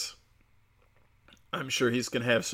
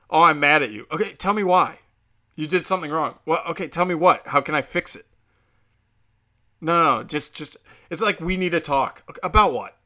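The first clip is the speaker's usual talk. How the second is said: acted quarrel; 4 semitones higher, 6 dB louder than usual. Acting out a quarrel.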